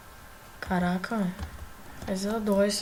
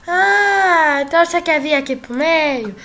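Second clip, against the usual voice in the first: loud, higher taunting call; 6 semitones higher, 11 dB louder than usual. Real, not acted. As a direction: call out the rival to come closer. Taunting opponent in game. (provocação gritada)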